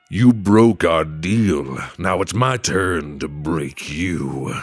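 slow, menacing southern drawl; scary southern devil. Acted. Devil avenging a broken deal, scary slow southern voice. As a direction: hit it hard, then sink into a southern threat. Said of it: "a little more like a scary Arthur Morgan kind of voice".

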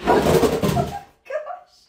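breathless high laugh into oh my gosh. Real, not acted. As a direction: laugh it out, barely voiced. Shocked at the dirty bathroom.

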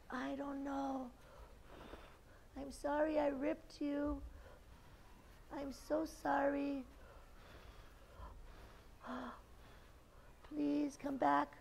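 high, soft pleading; mock-sorry coaxing, small voice; pretend remorse. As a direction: apologise meekly to the torn paper. Feigned regret to torn paper.

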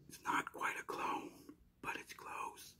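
confidential whisper, as if sharing a secret; whispered aside. Pretend. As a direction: drop to a hushed whisper. Whispered aside hinting at upcoming video.

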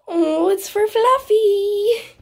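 high, drawn-out coo; cooing delight. Real, not acted. Player giving a crown to Fluffy, cooing high. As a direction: coo slowly and high over a pet.

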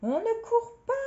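high, loud cry of reproach; shouted rebuke. Acted. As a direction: shout after someone racing off. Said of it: "lui cria-t-elle".

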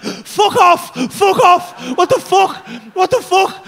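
loud high freaked-out yelling; panicked shouting. Retold or imitated. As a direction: scream it in disbelief. Panic at cartoon world.

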